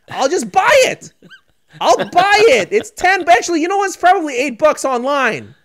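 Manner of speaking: high-pitched, worked-up parent insisting; exasperated parent. Acted. Parent offering son to buy the prize, exasperated. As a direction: pitch it up in mock exasperation with your kid.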